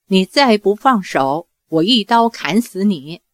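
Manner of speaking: ordinary delivery, nothing marked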